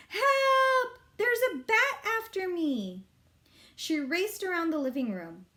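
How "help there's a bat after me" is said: high, loud frightened cry for help; panicked yell. A narrator's reading. Libby fleeing a bat, yelling in panic. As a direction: shriek it like you're being chased. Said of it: "Libby tore through the house yelling".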